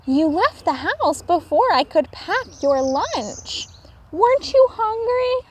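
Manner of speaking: high caring mother voice; mother calling. A narrator's reading.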